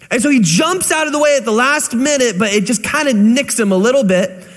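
ordinary talk, nothing marked

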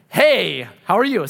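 overdone hello to a stranger; forced friendliness. Retold or imitated. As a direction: act out an awkward cold call. Demonstrates awkward cold-call greeting.

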